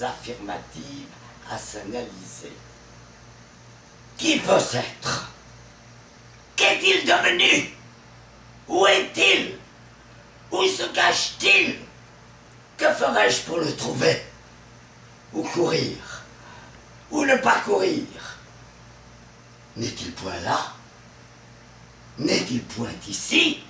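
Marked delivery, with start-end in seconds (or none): 4.2-18.0 loud, high-pitched volley of questions; Harpagon's frantic questioning. Acted. Robbed miser hunting the thief, frantic loud questioning. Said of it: "on passe à l'interrogation". 19.8-23.6 rising questions, the second louder; Harpagon's searching questions. Acted. Miser searching here and there for the thief, urgent questioning. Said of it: "on passe à l'interrogation".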